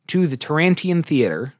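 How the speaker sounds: ordinary delivery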